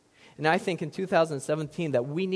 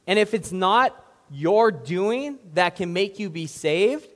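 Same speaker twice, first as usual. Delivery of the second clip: ordinary delivery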